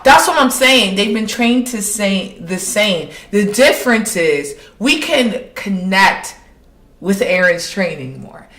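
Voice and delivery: normal talking voice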